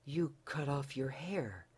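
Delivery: can't take it in; unbelieving. Acted. Husband stunned at wife's cut hair, disbelieving. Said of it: "said Tim unbelievingly".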